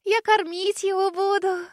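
put-on high, slow dreamy sigh of a child; little girl dreaming of feeding the dragon. A narrator's reading. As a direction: dream aloud like a small girl. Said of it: "мечтательно выдохнула малышка".

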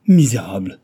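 loud, despairing outcry; cried out. A narrator's reading. Young woman learns her fiancé is dead, crying out. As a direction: hurl the accusation out loud. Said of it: "clama-t-elle".

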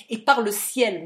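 loud, forceful vow; fierce oath. A narrator's reading. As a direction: swear it as a deadly threat. Hero swears a deadly threat.

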